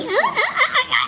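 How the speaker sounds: short high laughing "ah" bursts; playful high squeals. Pretend. Playing with the child. (maiikling tiling pabiro)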